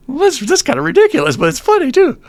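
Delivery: high, quick, tickled reaction; amused disbelief. Acted. Person looking down at ridiculous amoeba, amused disbelief.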